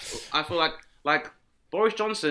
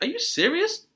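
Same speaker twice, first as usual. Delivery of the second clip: incredulous; 11 semitones higher than usual. Retold or imitated. Incredulous retold reaction.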